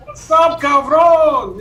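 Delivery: put-on playful Mexican street accent. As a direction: bark a cheeky Mexican hello. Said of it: "What do the Mexicans say?".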